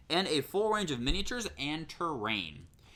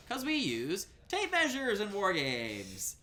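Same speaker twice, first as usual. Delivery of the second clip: slow, higher-pitched hammed-up announcement; grandly drawn-out punchline; mock proclamation; 6 semitones higher, slower than usual. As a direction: stretch it out like a big reveal. Mock announcement before wow effects.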